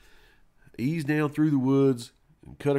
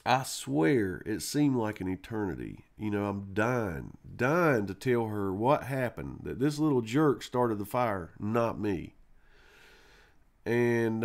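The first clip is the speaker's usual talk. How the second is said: ordinary talk, nothing marked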